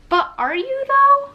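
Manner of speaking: incredulous retort. Retold or imitated. Vlogger questioning Americans claiming ancestry, incredulous high retort. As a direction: squeak it out in disbelief. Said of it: "And I'm like".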